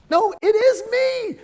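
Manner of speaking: very high, loud, drawn-out squeal; visual communicator. Shown as a demonstration. Trainer mimicking visual person hailing someone far off, high loud visual communicator. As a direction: mimic an over-eager caller, slow and very high. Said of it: "they talk like this".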